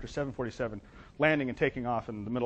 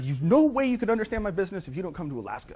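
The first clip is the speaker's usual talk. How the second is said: high, insistent client; Carlos Nelson; 6 semitones higher, 3 dB louder than usual. Retold or imitated. Client insists consultant fly to Alaska, high-pitched. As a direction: press the point in a raised voice. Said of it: "Carlos has a penchant".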